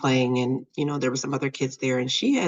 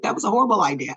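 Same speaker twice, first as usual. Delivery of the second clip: higher-pitched retold scolding; going off; 5 semitones higher, 4 dB louder, much faster than usual. Mom to daughter about the baby swing, going off. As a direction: act out a mom's telling-off. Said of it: "I'm, like, going off".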